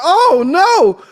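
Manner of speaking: high loud dismay. Retold or imitated. The viewer realises the truth, crying out loud in dismay. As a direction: cry out in horror. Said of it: "I was like".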